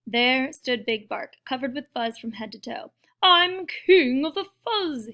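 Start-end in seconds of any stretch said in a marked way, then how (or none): 3.2-5.1 loud, high, drawn-out boast; snarling. A narrator's reading. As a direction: snarl it grandly, loud and slow. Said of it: "he snarled".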